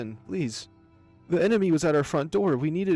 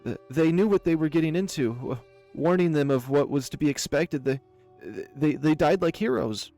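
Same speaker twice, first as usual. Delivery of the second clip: nervous excuse; 6 dB louder than usual. Acted. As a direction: stumble through excuses to save your skin. Cornered baron makes excuses.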